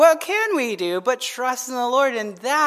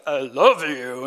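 low, slow mock-menacing reading; misread as harsh; 8 semitones lower, slower than usual. Reader misreading a kind Facebook post, mock-hostile. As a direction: drag it out low, as if it were sinister. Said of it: "they see it in a different light".

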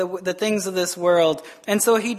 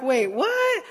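high-pitched comic double take; mock disbelief; 4 semitones higher, 4 dB quieter than usual. Reacting to a donkey that talks, mock disbelief. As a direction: jump up in pitch, incredulous.

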